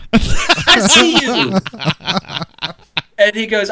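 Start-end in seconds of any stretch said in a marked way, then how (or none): 0.1-1.6 high, slow quote through laughter; amused, stretched-out mock reply; laughing imitation. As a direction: draw out the retold punchline, pitched up, cracking up. Retold punchline, teller laughing.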